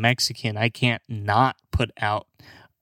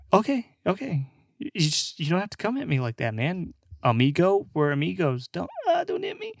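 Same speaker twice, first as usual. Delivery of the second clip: mock frightened pleading; 7 semitones higher than usual. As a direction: plead like a bro about to get hit. Acted bro pleads not to be hit.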